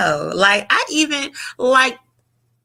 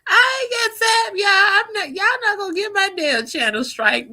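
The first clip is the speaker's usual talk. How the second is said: laughing; 13 semitones higher than usual. Real, not acted. Still laughing at the picture.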